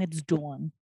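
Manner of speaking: dawn said with a Jersey vowel; imitating a Jersey accent. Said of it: "it's the Jersey accent".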